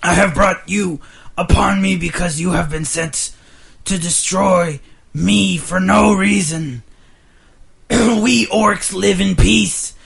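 loud, slow, proud king's speech; orc king voice. Acted. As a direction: address the captives like an orc king. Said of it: "kind of gravelly, a little lower".

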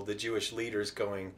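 ordinary talk, nothing marked

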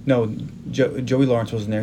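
ordinary talk, nothing marked

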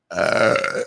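deep, loud belch; loud burp. Real, not acted. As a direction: let out a big burp. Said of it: "here comes a good burp".

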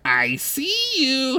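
drawn-out nerdy bard line. Acted. Lute nerd character speaks.

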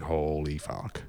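slow, soft "Holy fuck"; awed. Retold or imitated. Band watching Barry sing, stunned and hushed. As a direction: breathe it out in awe. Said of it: "we're all just sitting there going".

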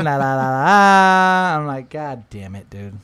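normal talking voice

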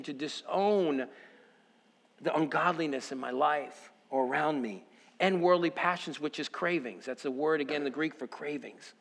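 ordinary talk

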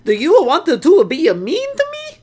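hammed-up Italian accent, high and loud. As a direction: play a broad cartoon Italian. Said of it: "make this offensive Italian accent".

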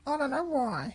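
very high falsetto dog; squeaky, puzzled little dog; dog's changed voice. Acted. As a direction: answer in a small squeaky pet voice. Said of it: "in a different voice".

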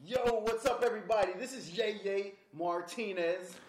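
loud, high hype intro; excited. Real, not acted. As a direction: shout the show open like a host.

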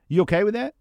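high, loud mock-casual question; pushy boss asking. Dominant person springing a task on a coworker, mock casual. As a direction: toss it off like it's not really a question. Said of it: "the dominant person says".

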